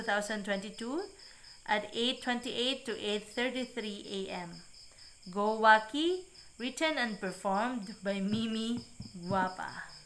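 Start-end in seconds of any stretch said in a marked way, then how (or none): none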